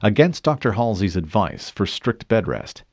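ordinary delivery, nothing marked

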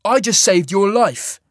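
loud, high, drawn-out reproach; snapping indignation. A narrator's reading. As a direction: throw it angrily at the giant. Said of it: "Cole snapped".

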